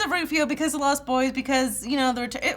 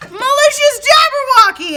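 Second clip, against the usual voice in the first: announcer voice; 12 semitones higher, 8 dB louder than usual. Acted. Dramatic slow read of asker name.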